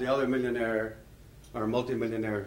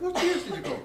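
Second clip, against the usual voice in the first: high, quick eager question; eager Irishman. Retold or imitated. Irishman in a pub quizzing a new friend, high and eager. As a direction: press for the years, thrilled. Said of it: "getting more and more excited".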